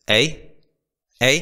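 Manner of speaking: jokey Canadian "eh"; mock Canadian tag question; Canadian accent. Shown as a demonstration.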